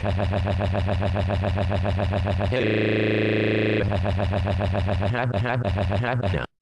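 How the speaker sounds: long, droning stuttered noise; monotonous machine-gun "ha-ha-ha"; imitated noise. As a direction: rattle off one syllable mechanically, on and on. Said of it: "and it sounded like".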